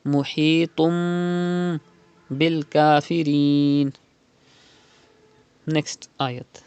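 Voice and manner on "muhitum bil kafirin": Muhitum very slow, nun turned to a humming m; iqlab demo. Shown as a demonstration. Teacher demonstrates iqlab with gunna, slow nasal. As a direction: hum the m into bil, slowly. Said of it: "change tanween into meme and do gunna".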